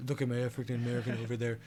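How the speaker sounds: fast, boastful Trump voice; Trump impression. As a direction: brag like Trump. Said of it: "That was my Trump impression".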